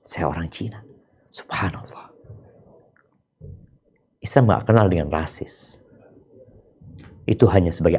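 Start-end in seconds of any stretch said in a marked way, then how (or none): 0.0-2.1 soft unvoiced whisper; hushed whisper. Preacher whispering an aside to the congregation. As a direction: drop to a whisper.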